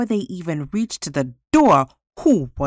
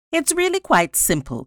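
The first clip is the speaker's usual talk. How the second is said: high-pitched, airy self-assurance; encouraged, self-assured; 11 semitones higher than usual. A narrator's reading. Judge advising admiring visitors, encouraged and self-assured. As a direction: explain it as if it were easy. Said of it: "encouraged by this respect and admiration".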